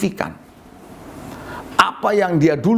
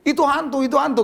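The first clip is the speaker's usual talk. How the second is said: higher, mock-frightened cry of ghost; frightened disciples; 8 semitones higher, faster than usual. Disciples on the boat crying out, mock frightened. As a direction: play the scared disciples seeing a ghost. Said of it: "Mereka bilang begini".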